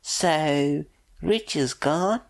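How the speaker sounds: high, stunned woman's question. Acted. The mother learning her ex is dead, high and stunned. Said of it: "says aloud".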